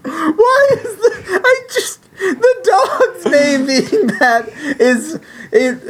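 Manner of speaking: high, squeaky words through tearful laughter; helpless laughter. Real, not acted. Cracking up over the dog's name, helpless laughter. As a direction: barely get the words out for laughing.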